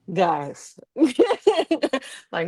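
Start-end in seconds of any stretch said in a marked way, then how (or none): 0.9-2.3 high, short disbelieving laugh. Real, not acted. Laughs after guys, guys.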